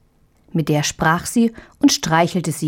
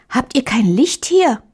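put-on higher, anxious child's question; uneasy little girl; 6 semitones higher than usual. A narrator's reading. Marie asks the mouse for light, higher anxious girl. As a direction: ask for a light like a scared child.

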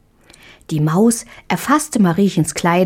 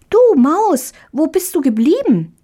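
put-on high, louder girl's call searching for the mouse; little girl calling; 10 semitones higher, 3 dB louder than usual. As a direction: call out for a lost friend. (Marie ruft nach der Maus)

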